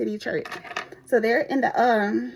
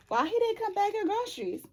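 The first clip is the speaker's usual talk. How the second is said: much higher, softer prying voice; nosy customer; 10 semitones higher, 3 dB quieter than usual. Retold or imitated. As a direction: ask it high and teasing. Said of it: "customers like".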